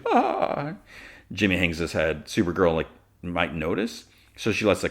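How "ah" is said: very high, drawn-out fake laugh; boisterous mock laugh. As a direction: imitate a showy laugh on one high cry. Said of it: "And she laughs boisterously".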